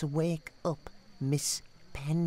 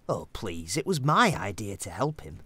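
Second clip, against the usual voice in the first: ordinary talk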